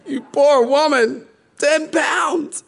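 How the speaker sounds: high, laughing exclamation of pity; mock shock. Pastor teasing mom over big baby, high mock pity. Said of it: "I went".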